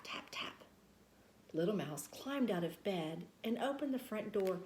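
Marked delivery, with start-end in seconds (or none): 0.0-0.7 breathy whisper; storyteller whispering the tapping; whispered tap. A narrator's reading. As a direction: hush it like a secret sound.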